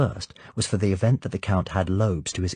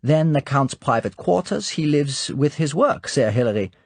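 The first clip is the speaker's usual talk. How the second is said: higher, louder put-on voice for the brisk guide; a brisk hostess voice, a notch higher and louder; Irma Bunt; 6 semitones higher, 5 dB louder than usual. Irma Bunt shows the visitor the count's quarters, brisk guide voice.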